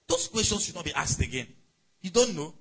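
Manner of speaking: normal talking voice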